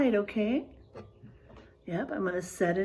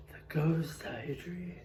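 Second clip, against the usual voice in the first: "The Ghost Eye Tree" whispered softly, no voice; spooky whisper; 7 semitones lower, 6 dB quieter, slower than usual. Shown as a demonstration. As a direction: breathe the title like a secret.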